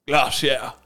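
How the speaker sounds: GLACIER said British, GLAH-see-uh; David Attenborough. Retold or imitated. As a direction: imitate a British narrator saying "glacier". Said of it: "in a really weird accent".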